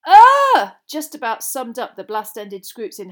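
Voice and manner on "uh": very high, loud cry of revulsion; disgusted squeal. A narrator's reading. The squeal summing up the Scroots, high and disgusted. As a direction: let out a piercing "ugh". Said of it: "squealed Lavender Brown".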